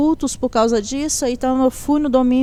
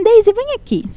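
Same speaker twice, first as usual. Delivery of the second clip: high-pitched retold summons; calling over; 9 semitones higher than usual. Nurse calls Deise over from the incubator, high-pitched beckoning. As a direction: imitate someone calling her across.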